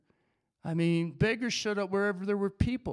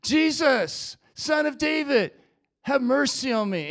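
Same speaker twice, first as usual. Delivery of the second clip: loud, higher-pitched cry for mercy; screaming out; 4 semitones higher, 5 dB louder than usual. Acted. Blind beggar calling to Jesus for mercy, loud crying out. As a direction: call out urgently over a crowd. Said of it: "he starts screaming out".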